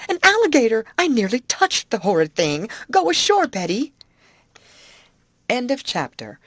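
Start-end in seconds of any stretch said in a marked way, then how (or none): 0.1-3.9 high, loud, panicky cry; frightened scream. A narrator's reading. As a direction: cry out in alarm, urging escape. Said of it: "she screamed".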